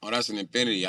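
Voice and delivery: ordinary delivery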